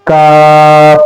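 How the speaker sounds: काफ with an open, held a; repeat-after-me kaf. Shown as a demonstration. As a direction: lead the class in a long काफ. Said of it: "अदा करें दोबारा".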